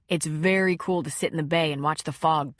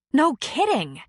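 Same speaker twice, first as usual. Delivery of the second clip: angry; 7 semitones higher, 3 dB louder, slower than usual. Acted. Angry retort to ex.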